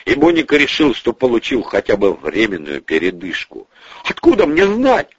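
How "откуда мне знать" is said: high-pitched protest; defensive exclamation. Acted. Bonifatsky answers the boss, exclaiming defensively. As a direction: exclaim as if wrongly blamed. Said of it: "воскликнул он".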